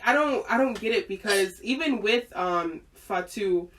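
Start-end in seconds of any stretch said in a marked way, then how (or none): none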